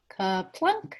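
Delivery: ordinary delivery, nothing marked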